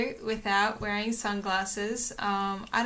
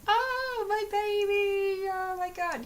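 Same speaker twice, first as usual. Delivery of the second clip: put-on much higher, slow, pleading "my baby" voice; mock anxious pet owner; 11 semitones higher, slower than usual. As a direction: imitate a fretting owner, pitched way up. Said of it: "people that are just like".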